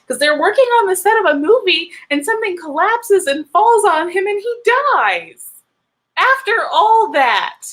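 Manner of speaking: high, loud outrage, drawing out the final blows; animated, upset rant. Real, not acted. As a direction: rant in exasperated disbelief, pitch soaring. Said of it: "I know I'm really animated. That's how I get when I'm upset".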